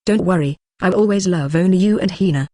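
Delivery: plain reading voice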